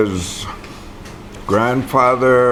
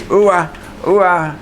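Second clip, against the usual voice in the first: put-on cooing to a baby; 8 semitones higher than usual. Parent to a little baby, high coo. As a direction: coo "Uwa, uwa" like to an infant. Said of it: "They tell little babies".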